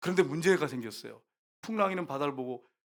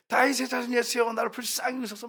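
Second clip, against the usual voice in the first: cried out much higher, pleading; mock shouted appeal, pitched up; retold desperate cry; 9 semitones higher than usual. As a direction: push the plea up high as she chases him. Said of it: "막 소리를 지르잖아요".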